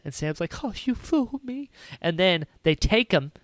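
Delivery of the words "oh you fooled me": high, soft mock-dismayed Sam; a light whiny hobbit gasp of surprise. Sam voiced in retelling.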